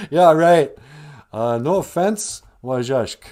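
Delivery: loud, laughing scorn; scoffing. Acted. The animals scoff at little Wajashk, loud and mocking. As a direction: brush him off with a mocking laugh.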